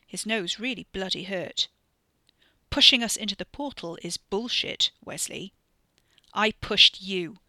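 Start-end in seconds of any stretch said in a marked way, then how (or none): 2.7-5.5 angry retort. Acted. Angry argument between cousins. 6.3-7.4 loud, slow admission; a slowed, loud confession thrown at his cousin; defiant shout. Acted. Wes shouts back.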